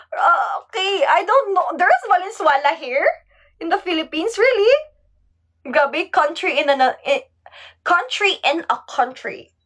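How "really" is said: surprised really. Real, not acted. Realizing Valenzuela is in Philippines.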